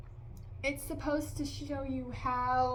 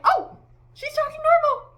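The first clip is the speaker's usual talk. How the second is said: high, loud burst of surprise; hammed-up astonished reaction; mock surprise; 12 dB louder than usual. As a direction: exclaim as if suddenly caught off guard. Mock surprise at the accent dropping.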